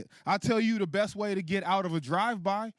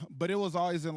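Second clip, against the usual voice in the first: usual speaking voice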